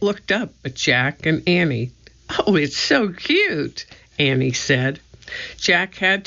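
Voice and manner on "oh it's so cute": slow, fond coo; adoring coo. A narrator's reading. Annie admiring the little mouse, slow fond coo. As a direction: melt over a tiny animal.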